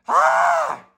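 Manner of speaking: very high loud cry of effort; triumphant shout. A narrator's reading. Engine cries out reaching the top, high and loud. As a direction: let out a high triumphant yell.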